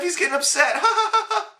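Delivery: high sneering fake laugh; a high, mocking ha-ha; mocking giggly girl. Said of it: "smirking and giggling".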